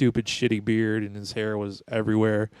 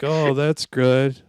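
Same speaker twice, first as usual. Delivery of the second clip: fake polite; 3 semitones higher, 5 dB louder, much slower than usual. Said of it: "I'm like".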